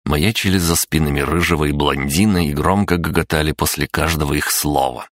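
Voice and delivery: ordinary delivery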